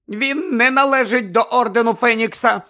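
high, loud, desperate protest; desperate cry. A narrator's reading. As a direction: cry it out in despair. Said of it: "вигукнула розпачливо місіс Візлі".

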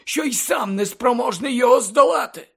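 put-on impassioned old headmaster explaining, weighing key words; Dumbledore's earnest lecture. Headmaster explains the tyrant's mistake to pupil, earnest and emphatic.

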